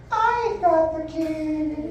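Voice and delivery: high, drawn-out game-show model voice; sing-song showgirl, very slow and high; Bob Barker Beauty. Shown as a demonstration. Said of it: "That's your best Bob Barker Beauty's voice".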